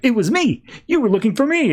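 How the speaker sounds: high, bursting eager voice; lightly voiced overeager son; eager blurt. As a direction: jump in like a puppy desperate to be noticed. [name] eagerly claims to be the one.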